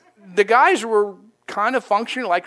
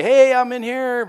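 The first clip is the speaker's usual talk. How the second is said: higher, louder hail on a held note; calling out; 6 semitones higher, 6 dB louder than usual. Retold or imitated. Someone alone in a dark room alerting a newcomer, calling out. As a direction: call out to be noticed.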